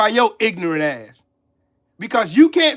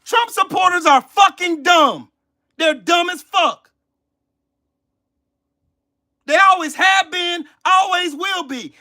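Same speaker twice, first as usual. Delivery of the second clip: angry shouting; 5 semitones higher than usual. Real, not acted. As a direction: shout it at the chat. Ranting at Trump supporters in chat.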